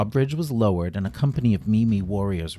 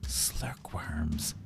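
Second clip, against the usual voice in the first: ominous hissed whisper; 5 semitones lower, 11 dB quieter than usual. Acted. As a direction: hiss the dreaded name, very soft. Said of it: "hissed the warrior".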